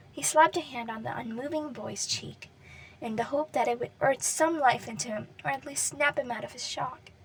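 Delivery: usual speaking voice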